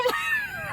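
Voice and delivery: high startled yelp; startled shriek. Retold or imitated. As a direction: jump and shriek in fright. Startled by man bursting in.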